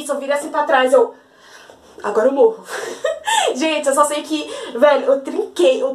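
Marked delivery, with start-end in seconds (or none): 2.7-3.5 short, high nervous laugh; a squeal of real laughter; high burst of laughter. Real, not acted. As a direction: laugh off the scary memory. Laughing after recalling the threat.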